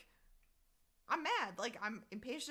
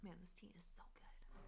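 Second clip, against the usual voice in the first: unvoiced whisper, much softer; 3 semitones lower, 13 dB quieter than usual. Real, not acted. Streamer whispers to chat while sipping tea. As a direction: breathe it like a private aside.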